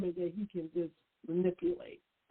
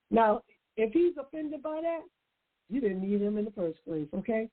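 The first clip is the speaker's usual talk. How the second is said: ordinary talk, nothing marked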